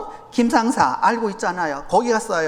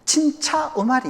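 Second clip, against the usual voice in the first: put-on slow, higher Japanese-accented Korean; 5 semitones higher, slower than usual. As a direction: say it like a Japanese speaker. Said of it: "이렇게 발음하는 거죠".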